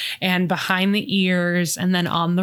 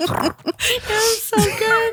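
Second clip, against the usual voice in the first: laughing; 14 semitones higher than usual. Real, not acted. Host laughing hard.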